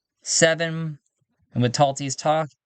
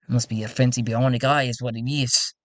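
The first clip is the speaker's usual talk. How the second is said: ordinary talk, nothing marked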